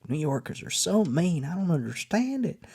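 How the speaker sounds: high-pitched imitation of a Southern lady; hammed-up high Southern belle voice; sweet Southern lady. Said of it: "there's a sweet Southern lady".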